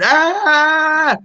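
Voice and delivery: long, high-pitched "aah" held out; drawn-out mock scream. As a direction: imitate a scream without full force. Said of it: "[name] here screaming".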